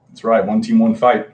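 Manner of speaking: ordinary talk, nothing marked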